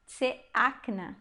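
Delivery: tseakna slowly, tse first, then a stressed AK and na; syllable-by-syllable demo. Shown as a demonstration. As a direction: spell out tseakna by ear, leaning on AK. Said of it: "a gente sim pronuncia tse".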